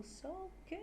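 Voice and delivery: soft, high "so good"; "so good" lifted high and drawn out; pitch going up. Shown as a demonstration. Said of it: "what we do with our language going up".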